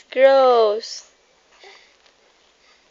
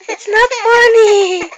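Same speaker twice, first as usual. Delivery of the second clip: higher, louder, laughing through it; "not funny" dissolving into laughter; 9 semitones higher, 6 dB louder than usual. Real, not acted. Scolding baby while laughing.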